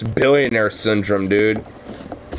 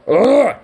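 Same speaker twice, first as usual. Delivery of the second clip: disgusted groan; 9 semitones higher, 4 dB louder than usual. Real, not acted. Disgust at the video.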